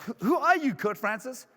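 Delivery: high-pitched acted challenge; indignant demand. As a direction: demand it like an accuser. Accuser challenges him again.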